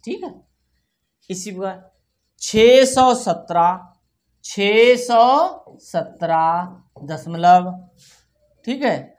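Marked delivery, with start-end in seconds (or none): 2.4-7.7 slow, very stretched number words; dictation. Shown as a demonstration. Teacher dictating a number to children, slow dictation. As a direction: draw each word out, louder, for writing.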